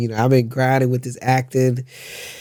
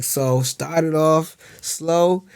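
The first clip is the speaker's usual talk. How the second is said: very slow advice; much slower than usual. Retold or imitated. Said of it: "He was like".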